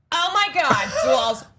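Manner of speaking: high, drawn-out dramatic entrance; mother impression. Friends imitating mom walking in, high theatrical diva. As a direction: play the diva mom bursting in, stretching vowels. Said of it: "do an imitation of my mother".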